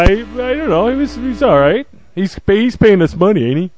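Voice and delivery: ordinary delivery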